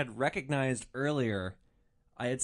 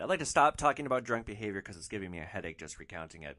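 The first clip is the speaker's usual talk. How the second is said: usual speaking voice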